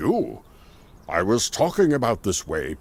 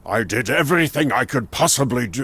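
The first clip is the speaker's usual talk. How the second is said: higher, quicker, emphatic repetition; insistent repeat; 5 semitones higher, 4 dB louder, faster than usual. Acted. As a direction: repeat it fast and forcefully, pitch raised. Repeats when asked, gets cut off.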